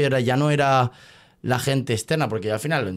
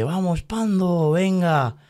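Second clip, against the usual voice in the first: higher, slow mock cheer of the crowd; 6 semitones higher, much slower than usual. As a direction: call it out like a cheering audience. (imitando a la gente animando, más agudo y lento)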